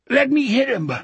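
put-on high, muffled boyish plea to fight; muffled cry. A narrator's reading. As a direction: cry out eagerly through a blocked-up voice. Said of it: "Sonny cried in his new muffled voice".

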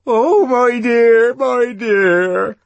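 loud, high-pitched tearful wail; crying. A narrator's reading. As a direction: wail it slowly as an overcome woman. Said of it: "The creature was crying".

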